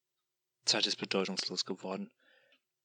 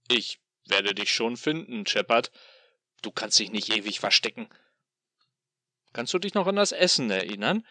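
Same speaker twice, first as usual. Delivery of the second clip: loud, sneering demon voice; gloating tormentor taunting his captive; mocking demon; 3 semitones higher, 6 dB louder than usual. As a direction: toy with him like a cruel god. Demon lures with food.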